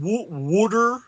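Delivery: slow Delco wooder, stretched out; drawn-out Delco water, wooder; Delco accent. Shown as a demonstration. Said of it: "There was my Delco accent".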